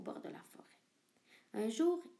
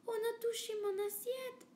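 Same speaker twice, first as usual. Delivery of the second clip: much higher mama bear voice; 12 semitones higher than usual. Acted. Mama bear complains her plate was touched, high voice. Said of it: "dit maman ours de sa moyenne voix".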